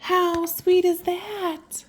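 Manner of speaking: high, slow, tender wonder; touched. Real, not acted. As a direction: melt at how sweet it is. Delighted aside.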